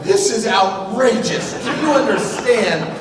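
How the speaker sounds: imitating his own furious complaint to the waiter; outraged. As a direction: spell out each word in fury at the waiter. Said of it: "I'm so angry".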